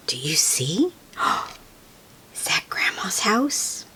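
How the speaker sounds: gasp, then breathless hushed whisper. Real, not acted. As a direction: whisper like spotting something secret. Hushed excitement, spotting Grandma's house.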